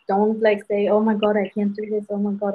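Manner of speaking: normal talking voice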